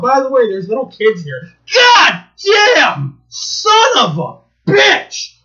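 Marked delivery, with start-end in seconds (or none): 1.7-5.3 high, loud, slow cursing yell; angry shout. Retold or imitated. Cursing the annoying ride operator, shouting angrily. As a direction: swear it out loud in fury. Reenacted outburst at fair.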